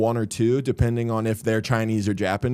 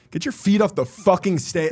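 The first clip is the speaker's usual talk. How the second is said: snapping at crowd; 6 semitones higher than usual. Retold or imitated. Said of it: "it just, like, snapped".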